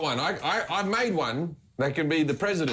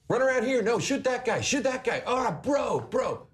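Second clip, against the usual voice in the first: high, excited gaming chatter; higher, urgent teen gamer voice; gamer kid on headset; 10 semitones higher than usual. Retold or imitated. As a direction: kid yelling orders to his mates online. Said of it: "And he'll be like".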